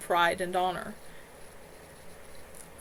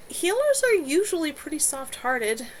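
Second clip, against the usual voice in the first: timid; 8 semitones higher, 4 dB louder than usual. A narrator's reading. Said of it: "Jason said timidly".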